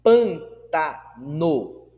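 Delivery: Pantano slow, split, PAN loudest; stress on PAN. Shown as a demonstration. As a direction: hit PAN, then set down TA and NO apart. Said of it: "o acento tônico é no pan".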